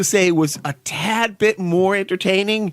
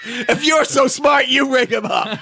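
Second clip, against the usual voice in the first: mock grump; 7 semitones higher, slower than usual. Mocking a grumpy character.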